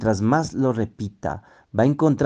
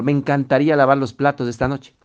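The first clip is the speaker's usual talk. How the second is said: mocking, higher, louder and quick; 4 semitones higher, 5 dB louder, faster than usual. Shown as a demonstration. Teacher shows spouse a mocking offer, higher and louder. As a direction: toss it off with a mock-eager sneer. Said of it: "dicha en un tono burlón".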